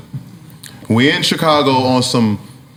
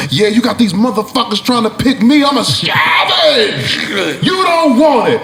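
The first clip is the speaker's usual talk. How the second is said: trash talk; 7 semitones higher than usual. Retold or imitated. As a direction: bark the boast right at him. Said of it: "I'm talking cash shit to Kel".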